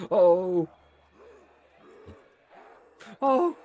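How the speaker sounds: loud high startled oh; alarmed yelp. Real, not acted. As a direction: cry out in sudden alarm. Teammate downed, sudden alarm.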